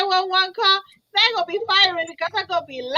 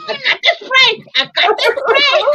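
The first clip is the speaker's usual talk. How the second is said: loud mock; 8 semitones higher, 5 dB louder than usual. Friend mimics her loudly.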